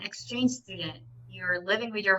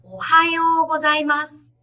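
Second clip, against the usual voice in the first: bright high-pitched ohayou gozaimasu; high pitch greeting; 7 semitones higher, 6 dB louder than usual. Shown as a demonstration. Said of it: "in a high pitch".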